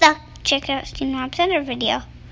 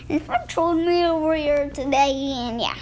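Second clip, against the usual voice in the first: normal talking voice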